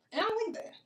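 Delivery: lifted pitch, dropped volume; half-sung soft disapproval; high soft aside. Real, not acted. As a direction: say it high and hushed. Repeats line, second take whispered.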